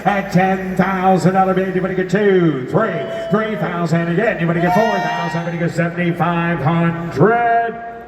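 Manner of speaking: high-pitched rolling auction chant; frantic trading floor shouting. Acted. Auctioneer calling for bids.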